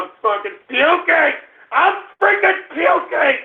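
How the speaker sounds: high, strained moaning while sick; high, distressed. Real, not acted. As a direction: whine it out mid-puke. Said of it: "I'm puking".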